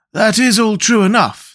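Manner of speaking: high-pitched, mildly uneasy reply; a little uneasy. A narrator's reading. Scarecrow admits to the boy they may be off course, uneasy. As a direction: concede it with a touch of worry. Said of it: "replied a little uneasily".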